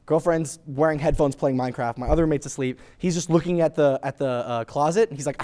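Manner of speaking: ordinary talk, nothing marked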